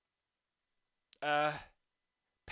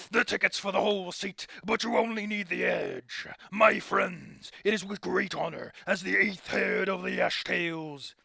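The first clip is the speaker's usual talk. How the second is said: louder, booming ringmaster address; showman announcer; 2 semitones higher, 4 dB louder, slower than usual. Acted. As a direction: sell the spectacle to the crowd. Announces the show to crowd.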